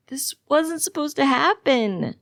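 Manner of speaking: high, slow, anguished yell. A narrator's reading. Patient told of a death, yelling in disbelief. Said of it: "I yell".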